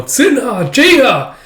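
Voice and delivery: high, loud cries of names; desperate calling. Acted. Fighter calling to his captured brothers, desperate shout. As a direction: cry out for your captured brothers. Brothers being taken, grenade thrown.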